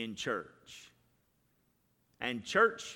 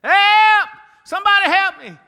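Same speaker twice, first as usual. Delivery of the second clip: screaming for help at the top of the lungs; frantic high screaming for help; panicked scream; 20 dB louder than usual. Retold or imitated. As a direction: shriek high and loud like running for your life. Agent screaming in joke.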